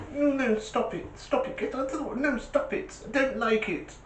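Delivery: very high, soft, fretful whine; weedy timid hooter. Retold or imitated. Pathetic tiny hooter begging the bulls to move, high and whimpering. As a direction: squeak it out like a frightened little horn. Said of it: "If this had a face ... it would be like this".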